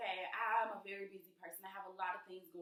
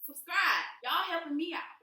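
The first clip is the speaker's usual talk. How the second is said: much louder and higher, punching subscribe; shouted plea; 9 semitones higher, 11 dB louder than usual. Real, not acted. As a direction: yell it at the viewers.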